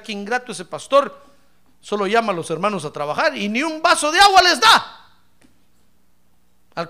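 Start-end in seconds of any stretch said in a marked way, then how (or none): 0.0-5.1 louder indignant grumble; mock complaining churchgoer. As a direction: complain loudly about the pastor. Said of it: "Tal vez alguien dirá". (queja indignada fingida)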